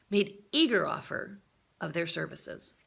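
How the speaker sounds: plain reading voice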